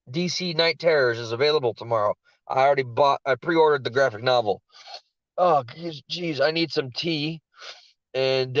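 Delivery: ordinary delivery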